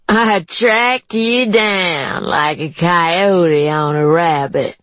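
teasing woman voice. A narrator's reading. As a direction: gloat over catching him. Juanita teasing.

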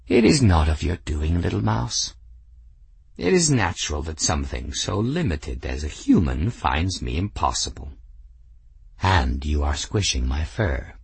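low, lofty cat voice; proud cat. Acted. Cat answering grateful Bridget, low and lofty. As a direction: speak as a cat who knows he's perfect.

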